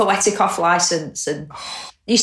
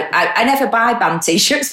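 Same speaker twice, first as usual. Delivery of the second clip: ordinary talk, nothing marked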